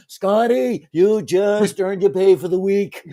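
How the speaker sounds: hammed-up starship captain impression; Kirk. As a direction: play the theatrical captain congratulating his engineer. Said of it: "And he goes".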